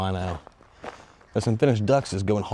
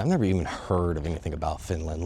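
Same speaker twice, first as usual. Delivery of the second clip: usual speaking voice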